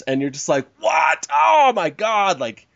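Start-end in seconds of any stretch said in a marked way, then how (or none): 0.8-2.4 high, louder mock disbelief; disbelieving shock. Scoffing at a writer's bad advice, mock shock. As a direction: gasp it out like you can't believe it.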